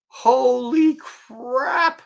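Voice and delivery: high, loud, drawn-out mock shock; stunned exclamation. Man learning the snake is deadly, hammed-up shock. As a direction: stretch it out high and loud in horror. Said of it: "And I'm going".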